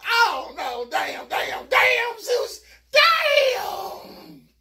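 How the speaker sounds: panicked cursing. Retold or imitated. Narrator panicking and cursing Zeus. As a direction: scream the curses out.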